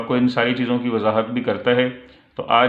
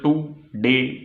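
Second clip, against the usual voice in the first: टू डे said as two separate syllables, टू, then डे; split into syllables; much slower than usual. Shown as a demonstration. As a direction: break टू डे apart between टू and डे. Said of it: "में दो syllable हैं".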